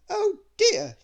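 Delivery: a prim little lady in dismay; Little Miss Splendid fussing. Acted. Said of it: "she said".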